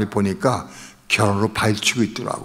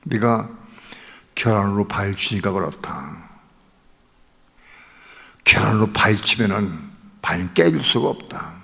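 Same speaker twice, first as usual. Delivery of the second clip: voice of God; 4 semitones lower, 4 dB quieter than usual. Retold or imitated. God answering the praying son, voice of God. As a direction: answer gently but firmly, lower and softer. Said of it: "하나님의 음성을 들은 거예요".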